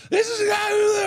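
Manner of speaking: put-on high, loud drunken yell; drunk shout. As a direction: yell it like a wasted fan.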